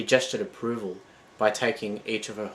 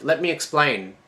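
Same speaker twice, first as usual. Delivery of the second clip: begging; 5 semitones higher, 4 dB louder than usual. Acted. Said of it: "she begged".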